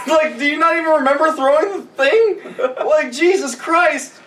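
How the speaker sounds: high voice through laughter; laughing disbelief. Real, not acted.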